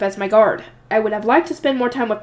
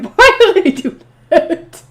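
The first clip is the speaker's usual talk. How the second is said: laughing, slow and loud; 6 semitones higher, 7 dB louder, much slower than usual. Real, not acted. Reader laughing at the cane flourish, laughing. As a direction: cracks up mid-question.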